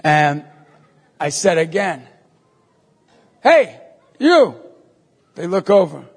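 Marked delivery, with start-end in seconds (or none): 3.4-4.5 two short, raised hails; calling out. Retold or imitated. Priest to strangers who looked away, calling out. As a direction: get their attention from afar.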